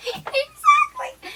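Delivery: squeals the word through helpless laughter; laughing. Real, not acted. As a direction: laugh it out, breaking high. Laughing hard at Clue joke.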